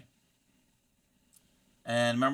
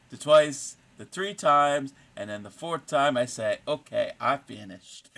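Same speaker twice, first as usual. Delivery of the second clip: mock Swedish accent; 4 dB louder than usual. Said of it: "it's a [name] thing".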